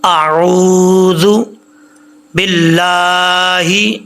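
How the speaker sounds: slow, drawn-out melodic tilawat; Quran recitation. Acted. Teacher opening the lesson, recites slowly and melodically. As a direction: chant each word long and sung.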